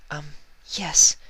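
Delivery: high, small admission; Fluttershy admitting her crush, nervous; shy yes. Acted. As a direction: squeak it out shyly. Fluttershy confessing.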